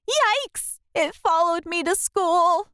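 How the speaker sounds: high startled yikes; alarmed yikes. A narrator's reading. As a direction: yelp it in fright. Narrator alarmed.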